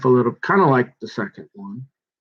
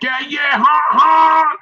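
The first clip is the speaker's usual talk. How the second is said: mocking laugh; 13 semitones higher, 4 dB louder, slower than usual. Mock reply in retold chat.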